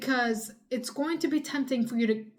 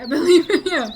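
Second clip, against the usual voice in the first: higher and much louder, laughing through it; 7 semitones higher, 12 dB louder than usual. Real, not acted. Cheering on a viewer fighting daydreaming, laughing. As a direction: burst out with delighted encouragement. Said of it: "I believe in ya".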